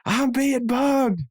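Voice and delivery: high, slow, drawn-out paranoid whine; Dale Gribble impression. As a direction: stretch it out in a high, panicked nasal Dale voice. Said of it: "he says".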